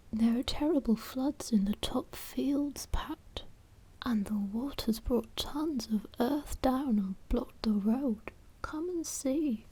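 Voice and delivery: whispered Mrs Thompson. Acted. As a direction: lightly lift the pitch for anxious Mrs Thompson. Mrs Thompson warns Pat of floods.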